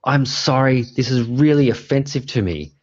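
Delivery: high, outraged protest from the crowd; indignant audience heckler. Retold or imitated. Dad heckles the play from the audience, mock outrage. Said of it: "he gets up and he goes".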